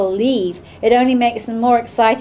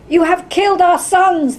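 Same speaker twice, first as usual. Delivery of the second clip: high, raised accusing shout; crowd shouting; 8 semitones higher, 4 dB louder than usual. A narrator's reading. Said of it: "they shout".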